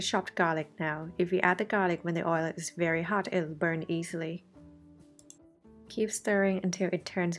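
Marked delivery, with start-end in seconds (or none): none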